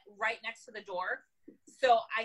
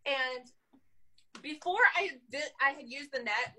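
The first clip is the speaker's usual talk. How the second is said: ordinary delivery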